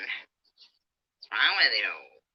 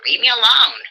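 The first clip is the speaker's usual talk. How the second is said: angry shout; 7 semitones higher, 7 dB louder than usual. Real, not acted. Angry live stream quarrel.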